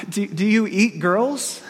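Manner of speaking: frightened girl asking the lion, lightly acted; fearful question. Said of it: "She asked fearfully".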